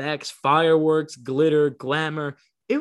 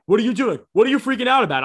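louder, higher puzzled voice; retold confused fans; 7 semitones higher, 6 dB louder, much faster than usual. People around him asking why he's freaking out. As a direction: ask it like baffled bystanders. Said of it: "So people are like".

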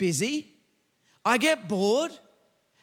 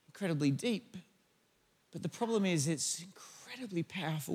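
much softer, lower pastoral sermon voice; intimate preaching; 8 semitones lower, 7 dB quieter than usual. Acted. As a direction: lean in and speak tenderly to the hearers. Soft intimate sermon section.

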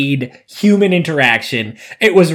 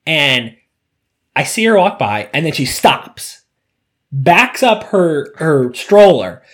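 usual speaking voice

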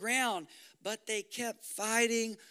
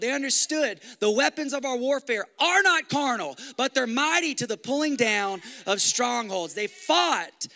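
full-force preaching; 3 semitones higher, 5 dB louder than usual. Acted. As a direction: preach it to the rafters. Sermon climax with music.

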